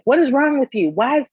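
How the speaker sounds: high, loud scolding outburst; retold going off. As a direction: act out a mom laying into her daughter. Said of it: "I'm kinda going off".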